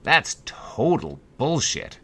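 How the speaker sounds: indignant customer growling at the board; scowling man. A narrator's reading. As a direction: spit it out slowly, disgusted. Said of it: "scowled a man".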